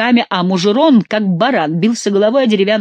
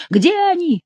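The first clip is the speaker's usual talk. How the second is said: very high-pitched cry; yelling; 13 semitones higher than usual. A narrator's reading. Favourite hunting for the Angevins, yelling. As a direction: wail the question up high without much volume. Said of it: "завопил Шомберг".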